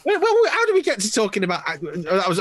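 lightly done chat-show host voice; a hint of Parkinson opening the interview; Michael Parkinson impression. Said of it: "that was my Michael Parkinson impression".

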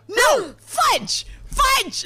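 high, loud shouted fudge with a gasp; retold yelled curse. Mom refusing husband, shouting fudge at the sink. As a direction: scream it out, won't stop. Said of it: "And I go".

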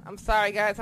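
usual speaking voice

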